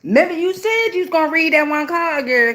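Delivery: high-pitched pestering viewer; reminding viewer. Acted. Viewer reminding the reader about a promised card, high-pitched nagging. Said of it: "make sure in the end you remind me".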